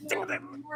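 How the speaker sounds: zapping sound. Retold or imitated. Imitating electric wire zapping.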